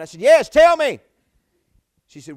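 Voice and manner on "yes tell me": loud, high, exasperated demand; impatient shout. Retold or imitated. Husband on the bus urging his wife to just say it, loud. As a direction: bark it out, fed up with waiting. Wife keeps asking if he's sitting.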